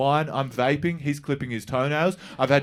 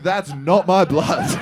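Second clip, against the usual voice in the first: slow deadpan; 4 semitones higher, 5 dB louder, slower than usual. Retold or imitated. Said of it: "And she was like".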